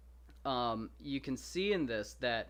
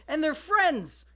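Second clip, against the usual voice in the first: much higher, louder joyful exclamation; delighted; 12 semitones higher, 9 dB louder than usual. Real, not acted. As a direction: shout it like the best twist ever. Delighted the heroes became friends.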